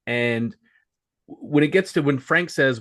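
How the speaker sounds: ordinary delivery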